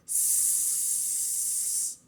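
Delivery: "s" as a long voiceless hiss; sound of S. Shown as a demonstration. Teacher isolating the letter sound, long hissed "s". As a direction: hold a bare "s" with no vowel. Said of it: "O som do S é".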